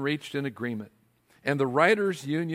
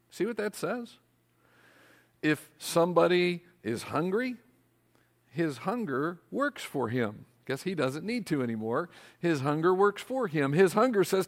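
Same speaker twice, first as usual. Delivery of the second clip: ordinary delivery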